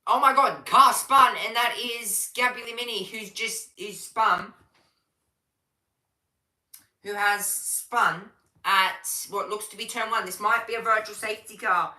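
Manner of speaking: urgent race commentator; excited play-by-play. Real, not acted. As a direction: call the sudden spin as it happens. Live call of a spin.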